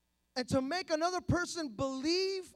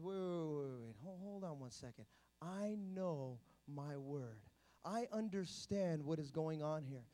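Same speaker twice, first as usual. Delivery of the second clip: low, soft, unhurried assurance; composed Jesus; 9 semitones lower, 8 dB quieter than usual. Acted. Christ refusing the enemy's temptation, quiet and steady. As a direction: calmly halt the tempter, slowing down. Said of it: "But see, Christ says".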